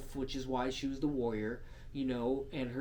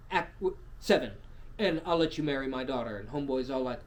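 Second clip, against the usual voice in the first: normal talking voice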